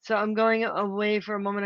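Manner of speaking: ordinary talk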